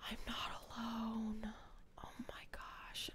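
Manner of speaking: put-on very soft, slow whisper of a kid; child's hushed realisation; whispered relief. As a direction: breathe it like a secret relief. Imagining kid's relieved thoughts.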